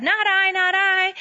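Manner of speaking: high sing-song chorus of refusals; storyteller's high animal voices saying no; animals refusing. A narrator's reading. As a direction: chant it up high like barnyard animals.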